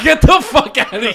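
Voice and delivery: loud-ish, high laughing disbelief; high-pitched retold outrage; mock incredulous. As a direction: blurt it out, scandalised and amused. Retold disbelief at friend's offer.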